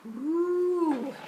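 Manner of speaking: high whooshing wind woo; wind howl. Acted. Imitating the wind.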